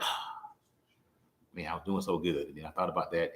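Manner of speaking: a soft, low, let-down murmur; deflated inner voice. Retold or imitated. Disappointed self-talk, said low and soft. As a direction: sigh it out like good news just went sour.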